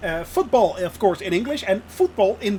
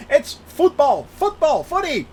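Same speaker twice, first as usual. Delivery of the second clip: high, louder insistence on football; indignant correction; 7 semitones higher, 4 dB louder than usual. Real, not acted. Streamer correcting chat's soccer, high and insistent. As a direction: correct them, raising the pitch.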